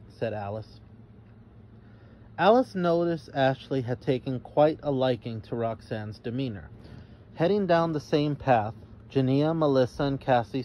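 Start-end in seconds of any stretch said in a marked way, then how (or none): none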